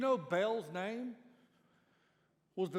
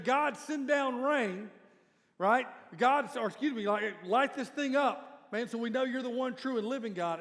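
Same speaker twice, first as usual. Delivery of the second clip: slow, loud, higher mock pleading; mock desperate prayer; 5 semitones higher, 8 dB louder than usual. Prophets of Baal begging their god, mock pleading.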